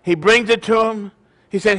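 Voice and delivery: ordinary talk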